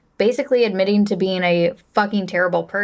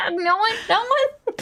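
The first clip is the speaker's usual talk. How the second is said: giggling through the words, a little slow; can't hold back the laugh while saying it; 12 semitones higher, slower than usual. Retold or imitated. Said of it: "I started laughing".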